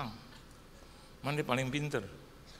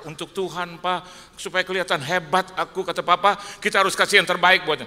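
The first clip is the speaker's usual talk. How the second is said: higher and louder, hammed-up pleading; mock loud plea; 8 semitones higher, 10 dB louder than usual. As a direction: belt out the daughter's plea in mockery. Mocking daughter's loud plea.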